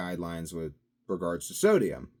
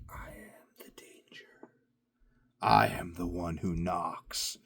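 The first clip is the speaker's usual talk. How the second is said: menacing Heisenberg quote; slower than usual. Pretend. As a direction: play the unstoppable villain, slow and ominous. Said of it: "your body's just like".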